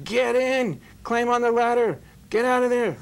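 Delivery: high, loud shout down from a helicopter; calling out. Retold or imitated. Helicopter rescuer calling down to the drowning man, loud high shout. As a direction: yell up over the rotor noise to get him in.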